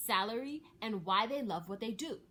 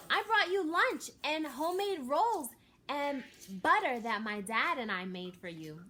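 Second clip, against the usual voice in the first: proud exclamation; 7 semitones higher, 7 dB louder than usual. A narrator's reading. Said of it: "L J proudly exclaimed".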